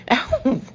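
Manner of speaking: sharp, high, loud ow; yelp of pain. A narrator's reading. Woman yelping as her boyfriend grabs her sore breast, sharp yelp. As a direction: yelp as it hurts.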